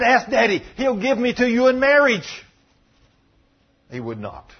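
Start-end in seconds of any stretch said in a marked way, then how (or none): 0.0-2.5 high, louder acted pleading; urgent plea, voiced for her; begging. Tamar offers her stepbrother another way, begging. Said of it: "And she begs him".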